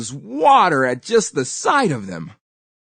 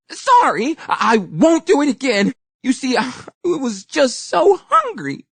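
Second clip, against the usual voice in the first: fake sincere; 10 semitones higher than usual. A narrator's reading. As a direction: pretend to be sorry, high and pleading. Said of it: "He tried his best to sound sincere".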